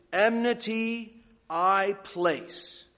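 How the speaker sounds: Enmity I place, slowly word by word with Enmity up front; attention-getting emphasis. Shown as a demonstration. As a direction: draw out "Enmity", pause, then "I place". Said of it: "That's what's supposed to get your attention".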